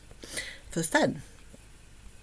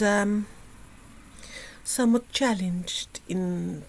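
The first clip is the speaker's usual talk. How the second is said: ordinary delivery, nothing marked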